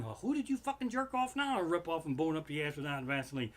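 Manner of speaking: normal talking voice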